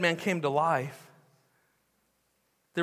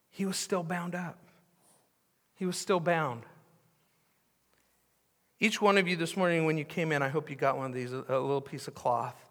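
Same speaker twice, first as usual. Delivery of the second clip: ordinary talk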